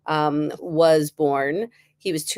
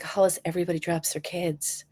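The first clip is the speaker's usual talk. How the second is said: calm doctor; 7 dB quieter than usual. Retold or imitated. Voicing the calm pediatrician.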